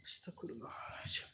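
faint, unsteady-free hushed whisper; whispered prayer. Real, not acted. Host prays between calls to the ghost, whispering. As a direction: whisper it to yourself. Praying under the breath.

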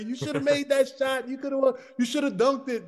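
a mild mock scold from an old woman; old lady heckling. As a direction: nag like an old fan off the bus. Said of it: "old lady ... screaming at me".

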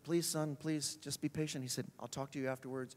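softly, patiently pleading; calm the heckler down gently; a soft, patient preacher; gentle plea. Retold or imitated. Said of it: "he'd say".

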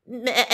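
high, loud, pulsing baa; sheep bleat. Pretend. Storyteller springing the sheep on children, high bleat. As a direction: imitate a sheep's stuttering bleat. Sheep noise in picture book.

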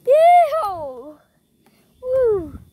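high cowboy yeehaw; excited whoop. Real, not acted. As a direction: whoop like a rodeo rider. Still on the spinning ride.

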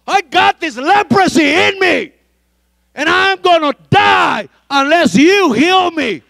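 high, loud pleading cry; desperate prayer. Acted. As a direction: cry out to God, slow and loud. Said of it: "You gotta say".

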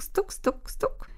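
quick, hushed high taps; light, clicky bird-peck noises; pecking sounds. A narrator's reading. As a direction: imitate little beaks pecking at grain. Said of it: "Inne ptaszki także stuk".

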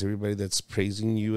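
ordinary talk, nothing marked

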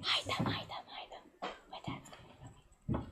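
real hushed, hurried warning; urgent whisper. Real, not acted. Warning a playmate that Dad is coming, whispered. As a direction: whisper so Dad can't hear.

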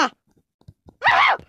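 piercing high scream; real panicked scream; terrified scream. Real, not acted. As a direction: shriek at the jumpscare. Jumpscare by chasing monster.

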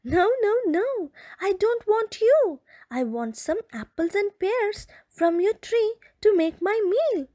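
a hint of high playful fox voice; lightly voiced cheerful fox; light giggly fox. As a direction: reassure her playfully as the fox. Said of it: "giggled the frisky fox".

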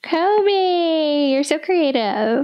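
high, drawn-out gush; singsong high admiring coo; gushing praise. Real, not acted. Gushing over friend's hashtag idea.

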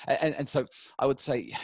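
ordinary talk, nothing marked

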